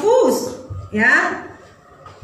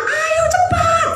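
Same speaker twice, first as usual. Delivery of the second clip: much higher, slow drawn-out "Ayo cepat"; cheering yell; much slower than usual. Retold or imitated. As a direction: call out to your child from the stands. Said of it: "Saya teriak".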